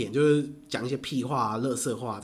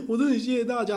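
mock sobbing thanks; 9 semitones higher, faster than usual. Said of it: "然后在台上啜泣".

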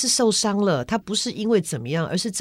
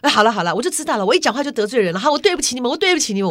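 higher, louder, martyr-like mock apology; sulky hurt outburst; 6 semitones higher, 4 dB louder, faster than usual. Mother sulking after being corrected, huffy higher mock apology. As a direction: throw up your hands, wounded. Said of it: "就急了".

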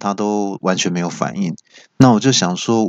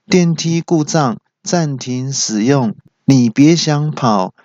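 slow, higher, measured recorded voice; elevator announcement voice; 5 semitones higher, slower than usual. Retold or imitated. Elevator announces fault to escaping friend, automated voice. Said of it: "电梯语音就发出声音说".

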